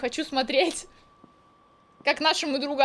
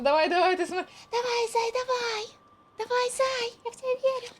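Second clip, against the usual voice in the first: hype friend cheering; 8 semitones higher than usual. Pretend. Cheering on character.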